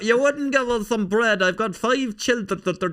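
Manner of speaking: very high, loud put-on wail; hammed-up pleading to a lord, ending in stutters; mock-deferential outburst. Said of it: "I just felt like".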